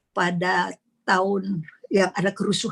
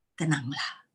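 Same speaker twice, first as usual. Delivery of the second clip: softer, lower, unhurried reassurance; calm and soothing; 6 semitones lower, 8 dB quieter than usual. Acted. Jesus calming frightened disciples, soft and reassuring. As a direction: settle a frightened friend quietly.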